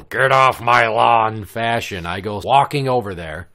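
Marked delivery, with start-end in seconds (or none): 0.0-1.4 loud, slow mock grouch voice; drawn-out, put-on grumble at full volume; get-off-my-lawn grouch. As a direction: holler it like a cranky neighbor. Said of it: "So in typical".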